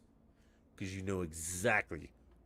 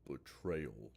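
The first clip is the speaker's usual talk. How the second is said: deep announcer voice; 5 semitones lower, 5 dB quieter than usual. Retold or imitated. Streamer half-attempts the Halo announcer, lightly voiced. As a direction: lower and softer, a dramatic one-word announcer call. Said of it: "I can't do the voice".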